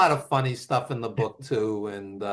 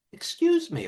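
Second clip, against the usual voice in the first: timid fan. Retold or imitated. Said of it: "she gets up the nerve and says".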